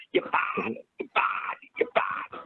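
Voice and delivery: put-on stepmother's Boston accent, near-voiceless repeats; mock Boston accent. Son mimicking his maddening stepmother, mock Boston accent. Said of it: "drove me nuts like fingernails on chalkboard".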